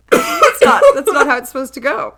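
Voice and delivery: high, loud indignant squeal; surprised. Real, not acted. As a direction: squeal it in protest. Indignant laughing protest.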